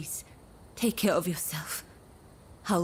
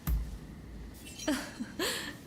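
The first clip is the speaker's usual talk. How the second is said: a light, airy breathy giggle of delight; soft giggle; 10 semitones higher, 6 dB quieter than usual. Acted. Woman giggles happily at the thought of going together. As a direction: giggle softly, pleased.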